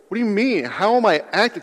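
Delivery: high-pitched, incredulous questioning; baffled protest. Acted. Accused helper answering back, baffled protest. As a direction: demand an explanation, taken aback. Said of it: "And you might go".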